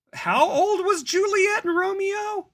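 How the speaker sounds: very high, slow, loud shocked cry; shocked kid. Retold or imitated. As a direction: squeal it like a scandalized student. Kids shocked reading Shakespeare.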